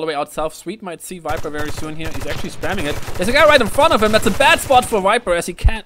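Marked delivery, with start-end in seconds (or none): 3.2-5.8 louder, higher live match call; excited play-by-play. Real, not acted. Caster spotting danger for the audience, excited play-by-play. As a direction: jump on the action with a loud, high call.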